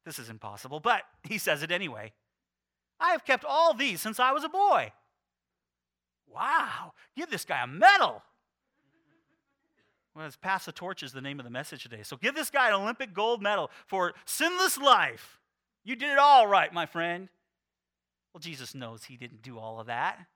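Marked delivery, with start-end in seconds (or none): 3.0-4.9 higher, louder boastful claim; proud declaration. Acted. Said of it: "he declared". 6.3-17.3 loud mock congratulation; sarcastic praise. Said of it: "mock praise".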